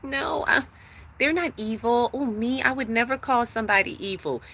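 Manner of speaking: high, shrieking mock innocent voice; mock prissy denial. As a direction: play the people-pleaser clutching pearls. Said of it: "busy trying to be like".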